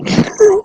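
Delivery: high squealing laugh. Real, not acted. Laugh at silly message.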